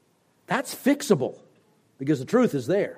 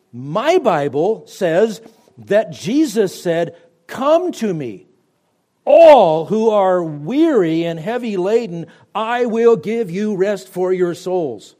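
loud slow preaching, pressing each phrase; 5 semitones higher, 7 dB louder, slower than usual. Acted. Preacher pleading with his listeners, loud and slow. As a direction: proclaim it to the whole room.

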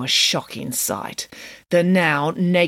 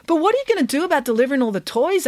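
put-on quick, higher boyish question; young boy voice; 9 semitones higher, faster than usual. A narrator's reading. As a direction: rush it like a worried boy. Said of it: "asked Derek".

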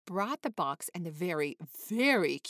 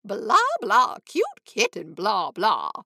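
a lightly mocked chatter, louder; mock blather; 9 dB louder, slower than usual. A narrator's reading. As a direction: toss off the blah blah like dull gossip. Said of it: "saying things like".

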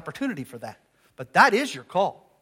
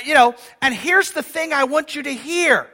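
usual speaking voice

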